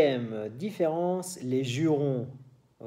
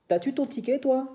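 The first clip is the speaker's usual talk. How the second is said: much higher playful Quebec question; Quebec accent; 12 semitones higher, 3 dB louder than usual. Retold or imitated. Teacher voicing a Quebecer asking for a ticket, high Quebec accent. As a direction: pitch the Quebec t'as-tu up high. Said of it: "por ejemplo".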